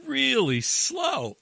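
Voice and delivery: high, stretched-out words; loud, slow storytelling emphasis; drawn-out slow. Retold or imitated. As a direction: drag it out to mimic a creeping truck. Acts out truck creeping up.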